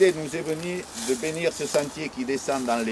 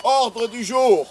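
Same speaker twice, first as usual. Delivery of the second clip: solemn announcement; 7 semitones higher, 3 dB louder, slower than usual. Pretend. Solemn reading of agenda.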